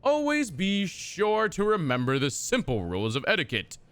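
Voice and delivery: a hint of a stately narrator; mock courtly reading. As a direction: read it like a dusty noble manual. Reads game codex text aloud.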